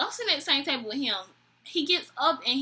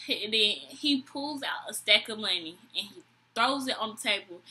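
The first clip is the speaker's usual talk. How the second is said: ordinary delivery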